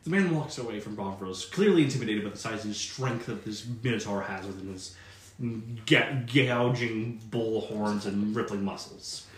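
low, steady scene-setting voice; game master narration. Acted. Game master describing the tavern, low and measured. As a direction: paint the scene for the players.

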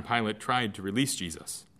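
usual speaking voice

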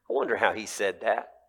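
normal talking voice

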